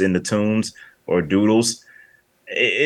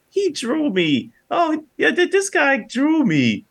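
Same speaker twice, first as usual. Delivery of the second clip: very high-pitched, drawn-out cartoon girl voice; mock falsetto impression of a cartoon woman; Holly; slower than usual. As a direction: put on a squeaky falsetto, stretching the words. Voicing half-naked cartoon Holly.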